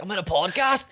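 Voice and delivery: mildly boastful mock announcement; imitating proud excitement. Friend imagining the dad hearing his shout-out, mildly proud. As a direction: play him lightly chuffed with himself.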